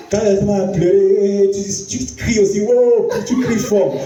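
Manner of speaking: half-laughing mock exclamation at loud crying; mock outcry. Mocking loud crying reaction.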